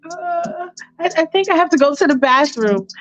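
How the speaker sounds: Michael nervously excuses himself after the kiss. Retold or imitated. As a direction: blurt a nervous excuse to leave.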